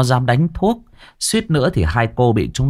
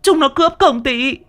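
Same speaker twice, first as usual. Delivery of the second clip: wailing scream. Acted. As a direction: scream for the neighbours, faking victimhood. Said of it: "lập tức gào lên". (gào thét the thé kêu bà con)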